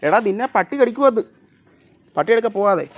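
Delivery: loud, high-pitched warning; urgent scolding shout. Real, not acted. Owner ordering a pet inside away from the dog, urgent shout.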